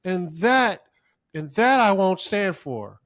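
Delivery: high, loud offended declaration; pompous mock indignation; mock righteous outrage. Mimics an offended, oversensitive person.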